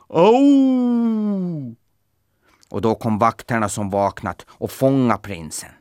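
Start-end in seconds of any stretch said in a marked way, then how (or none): 0.1-1.8 sharp, very high yelp; high cry of pain. A narrator's reading. Horse falling over, crying out in pain. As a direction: yelp as you tumble over.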